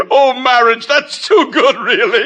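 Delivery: high, laughing scorn; laughing mockery. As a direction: laugh right at him. Mocking laughter at the poem.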